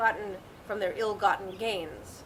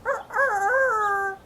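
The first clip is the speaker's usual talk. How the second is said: miserable rooster crow; 10 semitones higher, 8 dB louder, much slower than usual. Acted.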